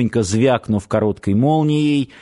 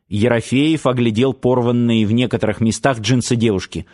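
usual speaking voice

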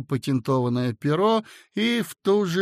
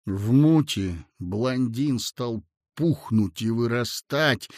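ordinary delivery, nothing marked

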